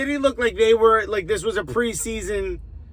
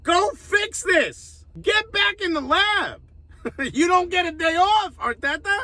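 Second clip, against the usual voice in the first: exasperated order; 7 semitones higher than usual. Real, not acted. Ordering the manager back to work.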